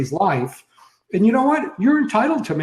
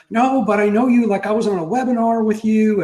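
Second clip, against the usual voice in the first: higher, louder imitation of an eager stranger; eager admirer; 7 semitones higher, 3 dB louder than usual. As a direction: act out someone keen to be remembered. Retelling a stranger eagerly recognizing him.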